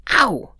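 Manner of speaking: sharp, loud yelp of pain; high, sudden cry of hurt; exclaimed "ow". A narrator's reading. Martin cutting his hand on the knife, pained exclamation. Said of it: "exclaimed Martin as he dropped the knife".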